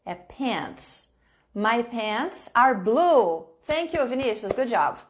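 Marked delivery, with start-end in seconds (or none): 0.2-1.1 "pants" said soft and deliberate with a clear final "ts"; clear pronunciation. Shown as a demonstration. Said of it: "não é pair, é pants". 1.5-3.5 "My pants are blue" very slowly and clearly; slow teacher model read; slow model sentence. Shown as a demonstration. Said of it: "model sentence for students".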